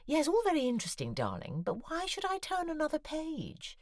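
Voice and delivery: high, airy imitation of an editor; mock Fiona. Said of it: "Fiona to get back to me saying".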